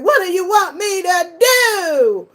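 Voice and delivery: high, loud whiny wail imitating a crying man; mock crying shout. [name] crying to [name], mock wailing shout. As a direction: wail it like a crying celebrity caught out. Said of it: "he was crying and he was like".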